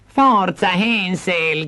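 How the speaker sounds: high sharp call; stepmother shouting. Acted. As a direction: hurry him up. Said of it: "gridò la matrigna".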